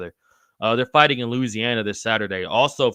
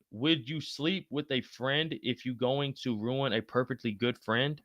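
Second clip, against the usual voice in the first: slow, softer word-by-word reread; puzzled slow reading; 8 dB quieter, slower than usual. Real, not acted. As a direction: read it slowly, working out the meaning. Rereading a confusing chat question.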